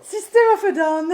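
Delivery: high, loud laughing answer. Real, not acted. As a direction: blurt it out through laughter. Guessing the gargled song, laughing.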